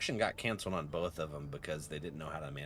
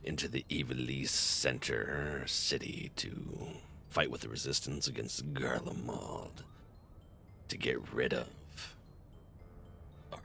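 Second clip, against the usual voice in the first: late night radio host; 5 semitones lower, 3 dB quieter than usual. Pretend. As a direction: lean into the mic like a late-night jazz show. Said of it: "my, uh, late night radio host, uh, voice".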